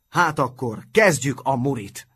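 put-on rally the boys to start the fight; shouted. A narrator's reading. Hordócska urges the boys into battle, shouting. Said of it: "kiáltott Hordócska".

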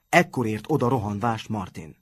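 ordinary delivery

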